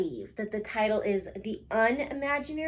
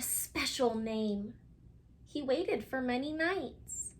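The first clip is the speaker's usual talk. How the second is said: storybook reading; 2 semitones higher than usual. A narrator's reading. Teacher reading a picture book to her class, gently. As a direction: read it like bedtime to little ones.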